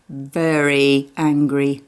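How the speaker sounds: ordinary delivery, nothing marked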